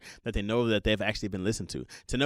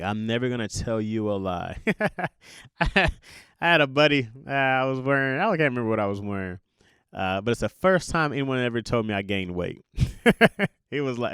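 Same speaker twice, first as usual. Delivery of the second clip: normal talking voice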